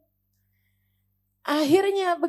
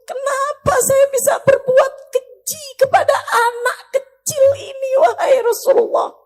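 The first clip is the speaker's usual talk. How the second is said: high, loud tearful lament; weeping confession; 7 semitones higher, 5 dB louder than usual. Retold or imitated. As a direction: plead in anguish through acted tears. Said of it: "Dia nangis depan Nabi Muhammad". (pengakuan sambil meratap pilu)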